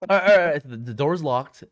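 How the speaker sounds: loud, laughing call to settle down; loud calming. Real, not acted. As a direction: shout over the laughter to get back on track.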